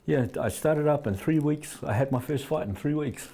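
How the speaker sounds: ordinary talk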